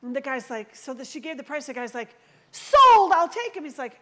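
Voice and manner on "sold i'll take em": sudden loud shout of sold; mock shout. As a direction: shout it out to claim the cupboards. Rival buyer shouting claim.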